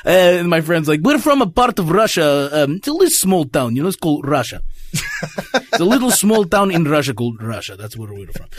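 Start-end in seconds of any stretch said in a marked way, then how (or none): 1.0-4.6 deadpan fake Russian accent; playing a Russian from a small town; put-on Russian accent. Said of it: "with a Russian accent". 5.7-8.5 put-on Russian accent. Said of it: "with a Russian accent".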